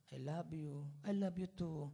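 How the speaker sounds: soft, tender lovers' exchange; tender sweethearts. Retold or imitated. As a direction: coo it like young sweethearts. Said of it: "ang lulumanay yung magsagutan".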